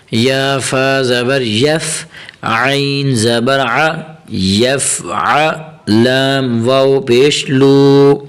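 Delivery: slow, drawn-out syllable chant; teacher reciting slowly for learners to follow; slow Quranic spelling recitation. Shown as a demonstration. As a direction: stretch each long vowel evenly as you spell it out.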